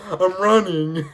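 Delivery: laughing while saying it, high and loud. Real, not acted. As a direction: laugh through the words. Running to the tram, laughing.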